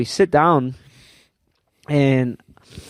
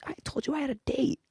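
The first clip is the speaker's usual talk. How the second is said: quiet whisper, much softer; hushed defensive whisper; whispered explanation; 12 semitones higher, 13 dB quieter, faster than usual. Retold or imitated. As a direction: whisper the excuse out of the corner of the mouth. Roommate explains in front of date.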